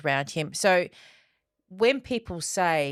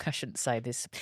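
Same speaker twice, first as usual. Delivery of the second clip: sheepish aside; 6 semitones lower, 8 dB quieter than usual. Real, not acted. Mum admitting a dark joke, quietly. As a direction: drop to a low, soft laughing aside.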